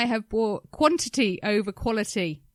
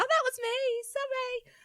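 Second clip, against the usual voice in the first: high, mocking voice of the woman; mocking half apology; 4 dB quieter than usual. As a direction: mimic her half-hearted sorry. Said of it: "she kind of apologised".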